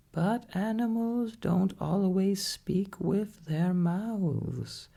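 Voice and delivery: a wise parrot setting the doctor straight; high parrot voice. A narrator's reading. Parrot correcting the doctor, in a high parrot voice. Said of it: "said the parrot in a high voice".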